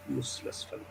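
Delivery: breathy hushed whisper of contempt; whispered scolding. Retold or imitated. Father whispering his scorn at the top student. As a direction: hiss it under your breath.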